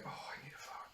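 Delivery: hushed, urgent whisper; whispered thought. Acted. Feeling a big fart coming, whispered to oneself. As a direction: whisper it like a secret warning to yourself.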